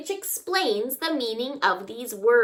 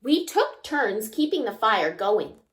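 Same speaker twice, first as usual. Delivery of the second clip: ordinary delivery, nothing marked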